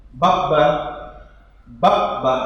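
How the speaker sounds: bab ba: closed bab then open ba; bab ba drill. Shown as a demonstration. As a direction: chant bab ba, stopping on the first b. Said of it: "dibacanya tiga huruf ba".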